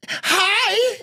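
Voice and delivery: hoog en opgewonden piepend hi; overly excited hi. Retold or imitated. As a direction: zeg hi alsof je veel te blij bent. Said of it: "She's like".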